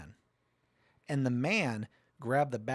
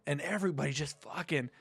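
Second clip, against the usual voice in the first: ordinary talk, nothing marked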